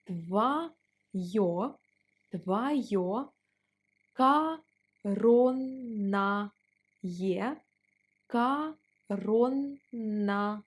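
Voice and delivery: Какое, твоё, коронное each repeated very slowly. Shown as a demonstration. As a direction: spell it out slowly, word by word, repeating. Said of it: "let's pronounce it slower".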